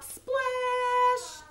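high, drawn-out "splash"; playful sound effect, held high; storybook splash. A narrator's reading. Sound word for frog jumping in.

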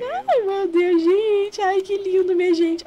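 high, loud gushing squeal over a cute scene; squealing delight. Real, not acted. Gamer squealing at a cute Sims mod. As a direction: gush with a giddy high squeal.

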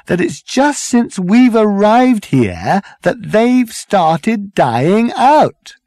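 slow, high, loud pleading; exasperated appeal. A narrator's reading. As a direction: drag the words out to make them understand. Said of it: "appealed to the whole crowd".

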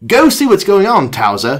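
British doctor commanding. Acted. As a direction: send the dog off briskly. British doctor orders his dog.

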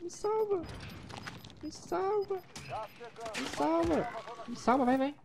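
high desperate begging; pleading falsetto. Acted. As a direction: beg for rescue in a high whine. Begging teammate to revive him.

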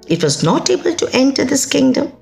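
plain reading voice

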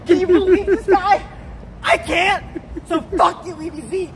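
yelling. Acted. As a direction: tear into him, shouting. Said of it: "I'm sorry for yelling at you".

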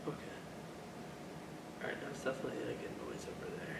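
hushed whisper, pitch dropping away; startled whisper. Real, not acted. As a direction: whisper it, wary of the noise. Reacts to noise in dark building.

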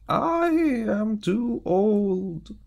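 put-on very high, loud and very slow old man; high, drawn-out old voice; old butcher voice. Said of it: "The butcher said".

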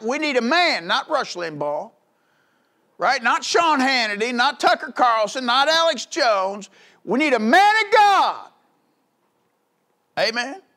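high, loud hammering cry; preaching at full force. Acted. Preacher crying out to the church at full force. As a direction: shout it from the pulpit.

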